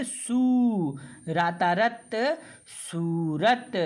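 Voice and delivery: सू held long, then a slow सूरत; syllable by syllable, a slow drawn सूरत; long oo in soorat. Shown as a demonstration. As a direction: sound it out, stretching the सू. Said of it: "सा का उच्चारण ज़्यादा स- समय के लिए करेंगे".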